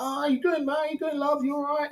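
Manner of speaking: high, bright mock hello; chirpy greeting. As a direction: put on a high friendly voice to greet her. Greeting the girl at the club.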